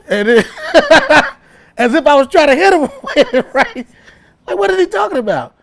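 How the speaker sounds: normal talking voice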